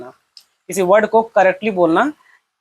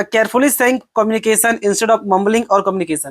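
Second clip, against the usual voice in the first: normal talking voice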